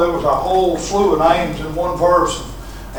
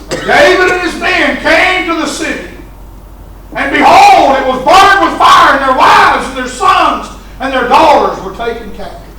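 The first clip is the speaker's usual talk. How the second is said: higher, louder shouted scripture; dramatic preached reading; 9 semitones higher, 8 dB louder than usual. Acted. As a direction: cry it out like a sermon climax.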